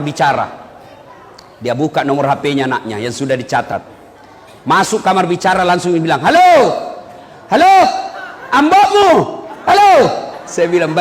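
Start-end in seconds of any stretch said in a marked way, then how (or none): 6.2-9.9 bumpkin shouting into phone. Retold or imitated. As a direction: play the confused old man yelling down the line. (meneriaki telepon dengan polos)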